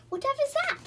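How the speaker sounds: high alarmed cry; startled. A narrator's reading. Beth woken by the owl, startled. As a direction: jolt awake and call out.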